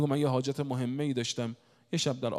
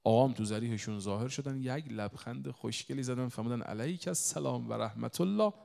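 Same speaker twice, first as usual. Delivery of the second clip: normal talking voice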